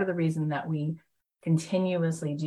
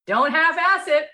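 loud, high, gruff mock dad bark; tough Texan dad; 12 semitones higher, 6 dB louder than usual. Texan dad answering his son's news, loud mock bark. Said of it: "his dad's response was".

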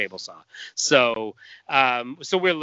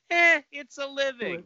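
weary dinosaur voice; 13 semitones higher than usual. Retold or imitated. Dinosaur in garage opener quoted.

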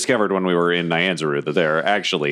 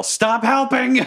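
high-pitched exasperated character yell; acted yell; slower than usual. Player's character yells back at helpful allies, high acted yell. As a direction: yell it over your shoulder, pitch shooting up. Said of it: "I look over my shoulder and yell".

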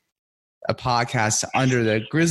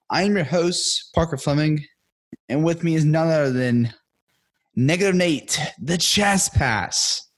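announcer intro; 5 semitones higher than usual. Acted. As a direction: stretch out the names like a ring announcer. Podcast host intro hype.

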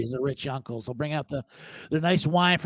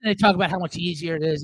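ordinary talk

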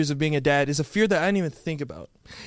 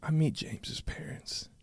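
hushed dramatic; 11 dB quieter, slower than usual. Pretend.

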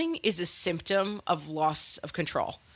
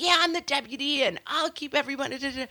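put-on excited kid; 8 semitones higher, faster than usual. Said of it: "he got really excited".

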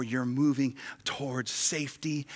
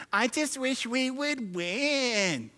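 whiny; 11 semitones higher, much slower than usual. Retold or imitated. Preacher mimics a whiner.

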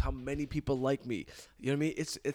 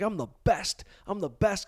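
cocky, raised-voice mantra; imitating his own swelled-head boast; 6 semitones higher, 4 dB louder than usual. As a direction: pump yourself up with swagger, pitched higher.

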